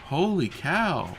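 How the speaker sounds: slow, drawn-out amazement; amazed. Real, not acted. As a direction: stretch it out in awe. Friend launched off world.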